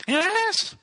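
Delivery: very high, slow robotic falsetto; sing-song auto-tuned robot voice. Pretend.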